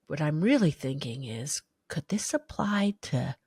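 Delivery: unvoiced whisper to herself; whispered thought. Wondering to herself in a hushed whisper. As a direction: breathe the question out, barely audible.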